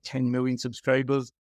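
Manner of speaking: ordinary talk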